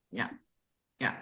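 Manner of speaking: ordinary delivery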